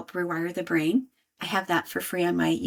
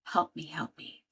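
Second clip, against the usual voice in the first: much softer, small plea for help; 5 semitones lower, 8 dB quieter than usual. Retold or imitated. A tired mother quietly begging for help, soft. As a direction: let it slip out as a hushed plea. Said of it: "That was my way of saying".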